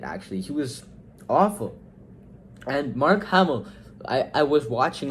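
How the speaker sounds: usual speaking voice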